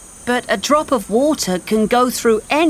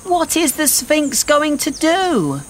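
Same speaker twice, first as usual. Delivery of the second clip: put-on high, anxious boy's question; anxious, high-pitched exclamation; worried cry; 13 semitones higher than usual. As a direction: ask it in rising alarm. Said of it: "Ben cried".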